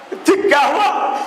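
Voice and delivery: put-on teacher asks the crying boy what happened; asking in surprise. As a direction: press the boy to tell.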